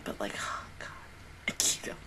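unvoiced whisper; real whisper. Real, not acted. Viewer whispering in dread about Akito.

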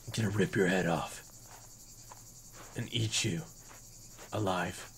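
low, soft menacing threat; low menacing growl. Pretend. Menacing threat to rival dino.

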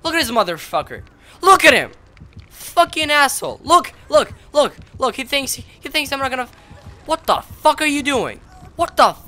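loud, high, repetitive ranting; real gamer rage; outraged. Real, not acted. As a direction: shout in disbelief at the opponent. Outraged at the camping killer.

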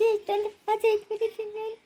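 high, broken crying voice; sobbing. Acted. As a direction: sob over shattered hopes. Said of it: "ಅಳ್ಬೇಡ ಸುಮ್ನೆ ಇರಮ್ಮ". (ಬಿಕ್ಕಿ ಬಿಕ್ಕಿ ಅಳುತ್ತಾ)

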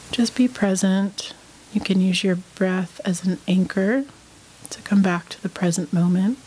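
unhurried meditation guide, long pauses between phrases; guided meditation. Real, not acted. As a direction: lead them calmly through breath and body, leaving space after each phrase.

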